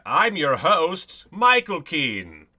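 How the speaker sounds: a touch of showman host voice; host intro. Pretend. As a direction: announce yourself like a TV host. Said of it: "I say my name like that".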